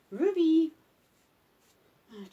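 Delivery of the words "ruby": loud, high call of the name. Real, not acted. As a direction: summon the dog sharply. Calling dog's name.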